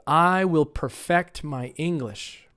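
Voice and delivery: slow model sentence with "perfect" said perFECT; softly and slowly, perFECT as a verb; second-syllable stress. Shown as a demonstration. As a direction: stress FECT in "perfect", drawn out for learners. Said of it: "try it on the second syllable".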